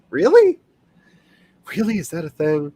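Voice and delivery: incredulous. Retold or imitated. Incredulous at the timer.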